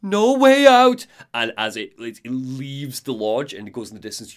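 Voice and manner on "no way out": loud, high, slow wail; moaning, building. Acted. Alan on the floor repeating himself, louder wailing moan. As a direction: moan swelling into a desperate cry. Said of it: "moaning to himself".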